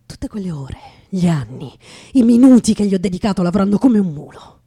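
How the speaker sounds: loud, rising rage over wasted years; furious shout about years of work; bitter outburst. Acted. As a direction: let the anger burst out.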